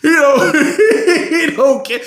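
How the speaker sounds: high squealing laughter; laughing. Real, not acted. Podcaster cracking up after his impression, laughing. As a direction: break up laughing. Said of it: "laughing at own impression".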